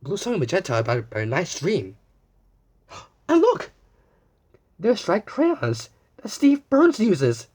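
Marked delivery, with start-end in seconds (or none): 2.9-7.5 excited. Acted. Excited discovery of crayons.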